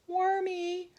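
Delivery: high call from the air; ringing high call. Acted. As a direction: call down to a friend. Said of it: "Hermie called".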